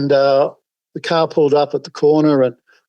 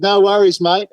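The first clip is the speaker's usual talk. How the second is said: higher, easygoing "No worries, mate"; a hint of a laid-back Aussie; Aussie bloke; 6 semitones higher than usual. Driver agreeing to donate, in a light higher bloke voice.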